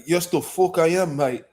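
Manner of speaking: cocky mock British accent, quick and proud. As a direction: answer like a Londoner who's been recognised. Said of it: "I'm like".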